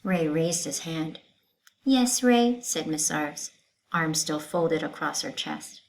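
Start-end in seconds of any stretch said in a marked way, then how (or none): 1.8-2.6 teacher voice. Acted. Teacher calling on student.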